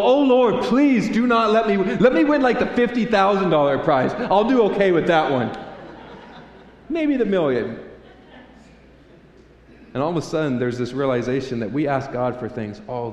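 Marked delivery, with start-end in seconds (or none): 0.0-7.8 high, loud, panicky prayer; mock fearful plea. Winner praying not to win the jackpot, mock fearful. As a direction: beg God in a comic panic, pitched way up. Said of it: "begin to be fearful and go".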